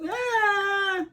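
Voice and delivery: long, high mock wail; drawn-out imitation of a squeamish whine. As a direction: wail like a wimp, high and stretched. Mimicking a squeamish person's wail of pain.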